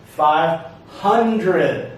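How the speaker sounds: "Five hundred" slowly, with HUN lifted; "Five hundred", falling away after HUN; syllable stress demo. Shown as a demonstration. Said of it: "Do you hear the syllable stress?".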